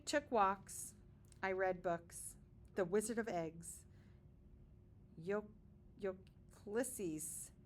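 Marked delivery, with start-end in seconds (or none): none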